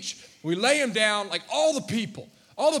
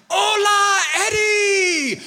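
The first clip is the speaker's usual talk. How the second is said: high, loud joyful shout of welcome; cheering; 11 semitones higher, 9 dB louder, much slower than usual. Retold or imitated. Congregation welcomes Eddie back, loud high cheer. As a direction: cheer him through the door. Church greets returning Eddie.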